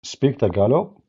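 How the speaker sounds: ordinary talk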